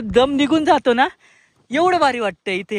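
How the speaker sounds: high, loud and slowed with delight; elated outburst. Real, not acted. Vlogger telling viewers the tiredness vanishes, elated. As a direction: pour out the joy of the summit. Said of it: "मी जास्त ओरडतोय".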